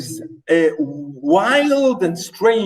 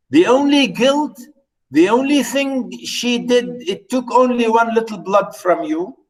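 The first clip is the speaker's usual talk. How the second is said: ordinary talk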